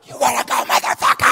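mock roar, voice stripped to a rasp; road-rage challenge. Comic retelling his outburst at a honking driver, mock road rage. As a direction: yell a fight challenge in a strained whisper-scream.

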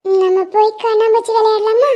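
put-on a tiny girl promising to stay; sweet child reassuring her friend; little girl voice. Little girl invites to play.

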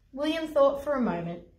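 ordinary delivery, nothing marked

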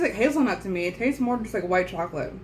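food in mouth; mid-bite chatter; talking while chewing. Real, not acted. Seen 2: Left speaker holds biscuit pieces, jaw chewing between remarks throughout.

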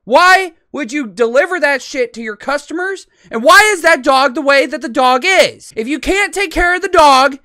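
high, loud angry rant; very heated. Real, not acted. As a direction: scold furiously, building to a shout. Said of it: "I have been getting very heated".

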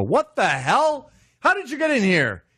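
startled squawk at an intruder; shocked president. Retold or imitated. Obama catching an intruder before his address, high startled squawk. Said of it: "you go".

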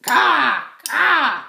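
loud, high crow caws; two piercing caws; crow cawing. A narrator's reading. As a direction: squawk like a crow. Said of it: "cries the crow".

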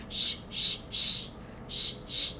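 short voiceless "sh" bursts; isolated SH sound. Shown as a demonstration. Teacher practising a sound with children, separate voiceless "sh". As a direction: repeat "sh" alone, crisply separated. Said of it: "Can you practice that sound with me".

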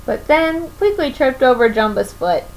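usual speaking voice

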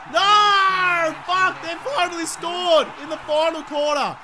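high, loud cry of dismay; upset yell. Real, not acted. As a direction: wail in protest as the other team scores. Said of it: "Now I'm upset".

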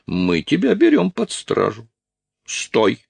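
lightly acted high-pitched order; a hint of a high-voiced officer; officer making an arrest.

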